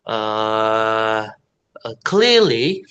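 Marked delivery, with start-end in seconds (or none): 2.1-2.8 CLEARLY with no r, like "clee-ly"; British r-less clearly. Shown as a demonstration. Teacher modelling British, slow CLEARLY without r. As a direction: stretch CLEARLY, r dropped. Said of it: "you have to say clearly".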